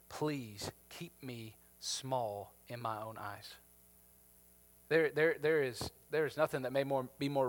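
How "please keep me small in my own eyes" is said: much softer, lower, slow whispered plea; pleading softly with God, low and slow; humble prayer. Real, not acted. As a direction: pray it gently under your breath. Quiet personal prayer.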